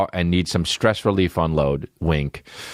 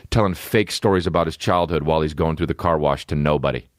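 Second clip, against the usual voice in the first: usual speaking voice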